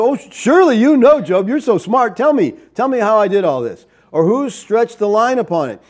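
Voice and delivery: high, loud mocking taunt; God taunting Job with heavy sarcasm; sarcastic God. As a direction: needle Job like a know-it-all. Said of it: "That's sarcasm".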